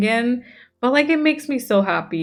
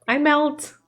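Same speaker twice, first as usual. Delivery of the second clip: higher, gushing emphasis on MELT; melting affection; 6 semitones higher than usual. Real, not acted. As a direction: swoon upward on "melt". Repeats it with heightened affection.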